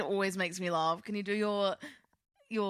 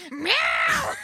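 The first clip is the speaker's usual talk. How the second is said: very high, loud cat meow; 9 dB louder than usual. Pretend. Trying her best meow again, loud high cat meow. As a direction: yowl like a cat. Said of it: "a really good meow".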